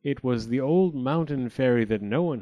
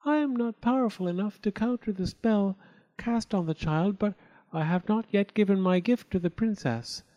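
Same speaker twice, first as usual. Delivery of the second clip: higher, gentle fairy voice; youngest fairy; 7 semitones higher than usual. A narrator's reading. Youngest fairy explaining to the queen, higher. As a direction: explain hopefully. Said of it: "she said".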